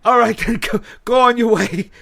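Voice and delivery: loud, high-pitched Irish-sounding officer; mock Irish accent. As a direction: send them off in a hammed-up Irish lilt. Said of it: "He's, he wasn't Irish".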